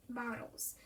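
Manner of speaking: soft breathy whisper of one word. Acted. Baby whispering to the others, a soft whisper.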